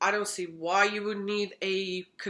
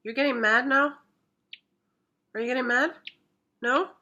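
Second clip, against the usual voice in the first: high, louder teasing voice; pet talk; 4 semitones higher, 5 dB louder than usual. Real, not acted. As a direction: tease the grumpy cat.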